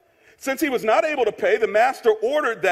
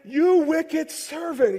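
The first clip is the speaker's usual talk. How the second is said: slow, drawn-out, raised-pitch rebuke; stern master; 4 semitones higher, much slower than usual. A narrator's reading. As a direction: stretch the accusation out slowly. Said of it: "Then the master called the servant in".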